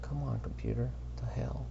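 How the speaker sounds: under-the-breath grumble, almost whispered; muttering at computer. Real, not acted. Podcaster to his balky computer, whispered grumble. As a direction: mutter crossly to yourself.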